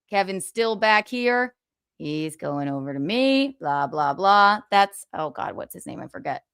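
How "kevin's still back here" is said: ordinary talk, nothing marked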